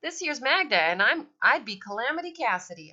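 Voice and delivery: loud, swooping put-on Southern twang; Southern accent. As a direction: introduce yourselves in a hammed-up Southern accent. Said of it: "in my best Southern accent".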